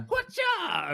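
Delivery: high, sharp karate cry; karate shout. Retold or imitated. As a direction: yelp it like a kick. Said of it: "then being like".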